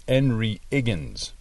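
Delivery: "Henry Higgins" said as 'enry 'iggins, both H sounds dropped; Cockney-style "Henry Higgins" with no H at all. Shown as a demonstration. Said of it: "how she pronounced Henry Higgins".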